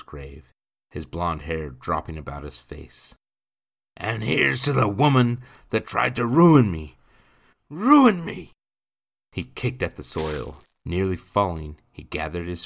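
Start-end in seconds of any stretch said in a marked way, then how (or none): 4.0-8.5 put-on loud, high drunken rage; raised-voice drunkard's jeer; drunken bitter toast. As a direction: rail drunkenly at the dead, rising on the repeat. Drunk man rages at wife's grave.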